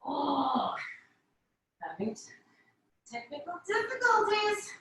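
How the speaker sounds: strained from holding a plank; straining, arms giving out. Real, not acted. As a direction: arms giving out under the load. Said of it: "my arms are giving out".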